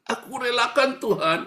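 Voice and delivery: loud, high mock-tearful surrender; hammed-up wailing prayer; fake crying. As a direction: cry it out as a put-on martyr. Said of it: "Karena pura-pura nangis".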